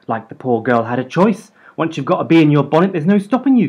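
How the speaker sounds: fast, high, loud retort; countering. A narrator's reading. Mum snapping back at gran, fast and loud. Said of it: "countered Mum".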